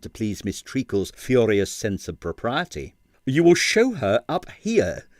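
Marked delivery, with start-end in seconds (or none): 3.3-5.1 slow, higher firm insistence; firm command. Acted. Quill insisting to his landlady, slow and firm. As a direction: lay down the law slowly. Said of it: "he said firmly".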